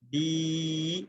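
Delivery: bi with the vowel drawn out long; long madd on bi. Shown as a demonstration. Teacher showing students a long vowel, drawn out. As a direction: stretch the ee in بی. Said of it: "با یا زیر".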